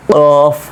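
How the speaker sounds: কফ from the root of the tongue, far back; back-of-tongue articulation. Shown as a demonstration. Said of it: "জিহ্বার গোড়াটা ভেতরের দিকে লাগিয়ে দিবেন".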